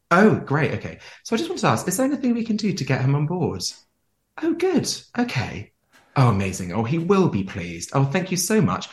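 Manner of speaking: high, gushing mock delight; over-the-top phone sweetness for the stranded passenger; gushing fake phone call. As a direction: lay the fake enthusiasm on thick. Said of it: "And then you go".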